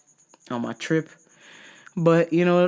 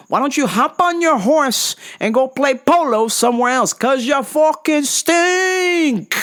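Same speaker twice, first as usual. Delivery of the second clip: retold calling out; 8 semitones higher, 3 dB louder, slower than usual. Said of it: "And I was like".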